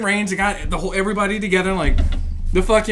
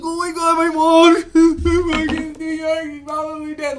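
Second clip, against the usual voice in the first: mock panicking recruit; 4 semitones higher than usual. Imitating fellow recruits worried about family, whimpering mockingly. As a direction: imitate a recruit fretting, small and high. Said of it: "telling all the other recruits".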